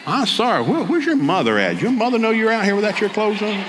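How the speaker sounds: high-pitched, bewildered scolding; mock scolding. Man asking topless protester about her mother, mock scolding.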